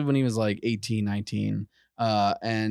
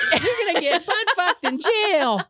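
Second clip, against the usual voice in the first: high mocking voice breaking into laughter; laughing taunt; 3 dB louder than usual. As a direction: jeer at him while laughing. Mocking the hiding man.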